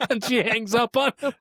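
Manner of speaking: high laugh breaking through words. Real, not acted. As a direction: laugh while finishing the story. Laughing at the story.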